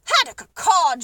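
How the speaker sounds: loud, scornful shout. Acted. Duke scorning the pelican's request, shouting in disgust. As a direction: spit the words out like a bad taste. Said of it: "shouted the Duke".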